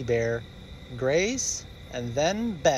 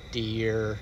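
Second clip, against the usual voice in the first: plain reading voice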